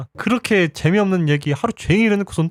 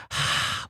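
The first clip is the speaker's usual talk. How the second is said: weary sigh; 9 dB quieter than usual. Retold or imitated.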